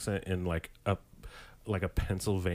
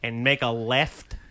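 slow South Side Chicago cop; drawn-out Chicago accent; 4 semitones higher, 5 dB louder than usual. Acted. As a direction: give directions like a lifelong Chicago cop. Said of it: "like, the cops or something".

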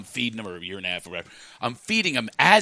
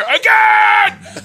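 put-on high, loud toddler yell; thrilled toddler demand; 9 dB louder than usual. Toddler thrown in the air, demanding it at a high shout. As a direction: holler it like an insatiable kid. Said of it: "his hair is still flying in the air".

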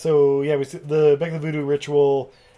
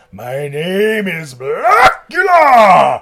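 slow, loud, rising bellow; hammed-up defiant roar; Blackula roaring; 5 semitones higher, 11 dB louder, much slower than usual. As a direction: draw it out into a huge cry. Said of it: "he's like".

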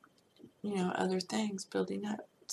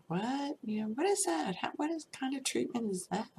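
incredulous; 7 semitones higher than usual. Retold or imitated. Incredulous quote about Geithner announcement.

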